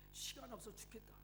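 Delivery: breathy whisper of a tired grumble; whispered complaint. Acted. Mrs Yang whispering her habitual complaint. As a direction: whisper it like a sigh.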